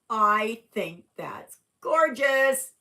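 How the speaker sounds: high, loud, slow gushing over it; excited admiration. Real, not acted. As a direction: gush with delight. Admiring own work.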